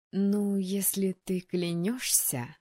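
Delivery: slow, mollified drawl; drawn out, softening. Acted. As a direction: stretch it out, relenting a little. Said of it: "немного подобрев, протянула Генриетта".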